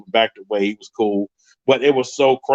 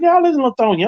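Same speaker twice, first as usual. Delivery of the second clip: very high, louder mocking quote; mimicking Sean; 13 semitones higher, 4 dB louder, faster than usual. Narrator imitating Sean's reply, very high and mocking. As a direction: squeak out the friend's line.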